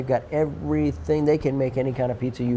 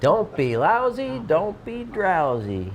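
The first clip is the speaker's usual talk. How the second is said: sing-song slogan; 5 semitones higher, slower than usual. Acted. Jingle-like slogan, slow and high.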